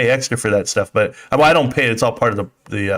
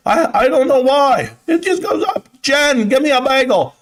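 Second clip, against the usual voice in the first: Uncle Bruce impression; 13 semitones higher than usual. Mocking Uncle Bruce for the stream chat, high yelling impression. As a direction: squeal it out like a hyped-up uncle hawking his wares. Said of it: "Uncle Bruce".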